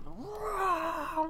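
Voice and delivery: mock roar at cows. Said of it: "turned around and went".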